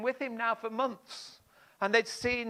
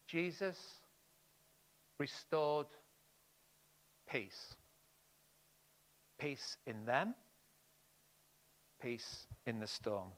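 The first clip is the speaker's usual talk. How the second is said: hushed reverent; 5 semitones lower, 11 dB quieter, slower than usual. Acted. Hushed climax of the story.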